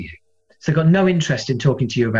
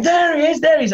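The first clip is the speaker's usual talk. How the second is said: much higher, delighted cry of recognition; thrilled fan; 13 semitones higher than usual. Retold or imitated. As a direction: spot your hero across the room. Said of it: "he's going".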